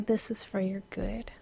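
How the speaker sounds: soft whisper. Retold or imitated. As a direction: breathe it gently. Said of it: "heavenly thump".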